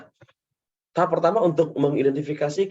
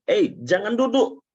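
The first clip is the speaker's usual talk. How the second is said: telling someone not to sit; 7 semitones higher, 4 dB louder than usual. Shown as a demonstration. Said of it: "Contoh misalnya".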